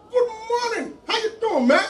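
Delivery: high, bright American-style hello to a beggar; mock friendly American guy; cheery American greeting. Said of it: "American guy".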